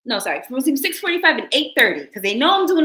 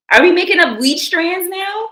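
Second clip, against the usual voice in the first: incredulous; 9 semitones higher, 5 dB louder than usual. Real, not acted. Incredulous at chat's claim.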